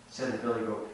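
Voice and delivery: ordinary delivery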